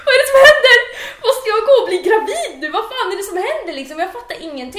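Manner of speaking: half-laughing replay of a panic attack; frantic shrill outburst at a doctor's news; retold panic. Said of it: "jag fick så här panikångest".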